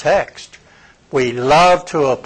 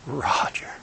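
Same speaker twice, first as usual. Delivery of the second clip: breathless whisper of the name; whispered Roger; 2 semitones lower, 11 dB quieter than usual. Retold or imitated. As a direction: whisper it through tears. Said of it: "with tears running down his face, he says".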